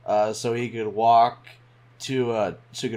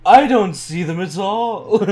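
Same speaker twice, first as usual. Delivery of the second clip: panto villain; 7 semitones higher, 7 dB louder, slower than usual. Pretend. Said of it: "Like a villain from a Panto show".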